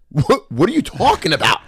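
high-pitched retold exasperation. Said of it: "I loved how your voice raised".

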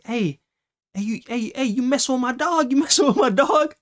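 high-pitched mock confrontation voice; angry dog owner. Stranger confronting the kids about his dog, high mock accusing voice. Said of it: "we hear a voice like".

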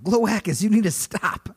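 loud, high scolding of a monster; play-acted exasperated outburst; mock pleading shout. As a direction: call out high and loud to stop it. Playfully pleading with rampaging monster.

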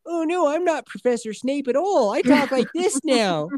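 a hint of a villain pretending to be scared; mock fake hostage. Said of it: "He's like".

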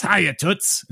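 high, squawky duck voice; Howard the Duck. Retold or imitated. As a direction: play it as a cartoon duck greeting. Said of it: "That was more of a, was more of a, Howard the Duck than Donald".

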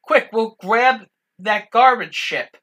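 urgent call. Retold or imitated.

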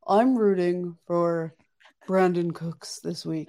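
a slightly slowed, lightly voiced imitation of Jake; Jake impression. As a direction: do a half-hearted impression of your cohost. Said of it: "this is my best Jake impression".

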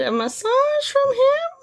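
excited. Real, not acted. Looking at hot therapist photo.

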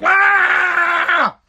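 high furious "Ah!" yell; angry scream. Retold or imitated. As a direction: scream out in rage, trapped. Retold scream in cage.

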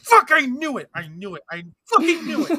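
high, loud outburst of playful vindication; mock-outraged shout. Friend hearing the Space Chimps reveal, mock-outraged shouting.

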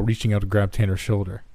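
ordinary delivery, nothing marked

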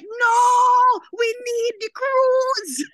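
high, loud mock plea; a cried-out no and slow, pleading high voice. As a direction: shout it like you're begging them to save the cruise. Retelling pleading with the group.

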